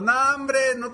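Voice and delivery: very high mocking shouted jeers; jeering animals. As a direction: heckle the little frogs. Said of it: "seguían gritando". (burla aguda a gritos)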